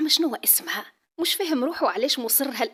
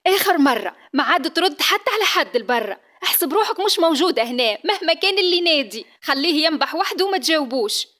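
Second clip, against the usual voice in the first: angry scolding; 5 semitones higher, 7 dB louder than usual. Acted. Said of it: "يوبخ فيها ... ويتكلم بحصب".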